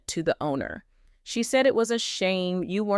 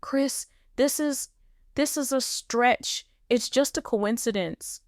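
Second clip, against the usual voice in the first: lacking conviction; 6 semitones higher than usual. Acted. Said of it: "his voice lacked conviction".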